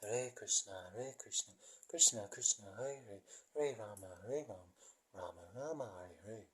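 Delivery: slow mantra with falling, swaying tune; slowly. Shown as a demonstration. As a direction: draw out each name, softly, letting it fall. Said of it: "Maybe one more time slowly".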